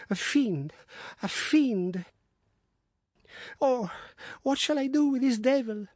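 delirious wife crying out about a devil; delirious raving. Acted. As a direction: rave in feverish terror.

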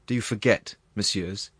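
plain reading voice

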